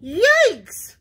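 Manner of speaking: high, loud alarmed squawk. A narrator's reading. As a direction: squawk in sudden fright. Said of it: "squawked Missus Chicken".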